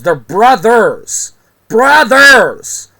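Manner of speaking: loud, slow, drawn-out "brothers", climbing to a high shout; exasperated correction. Real, not acted. Vlogger correcting fans on the Mario name, exasperated. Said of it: "Pisses me off".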